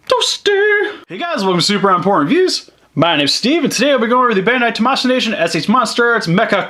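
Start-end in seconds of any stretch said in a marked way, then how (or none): 0.1-1.0 very high squeaky game voice; high-pitched Toasty call. Pretend. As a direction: squeal it like the arcade easter egg. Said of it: "that announcer coming from".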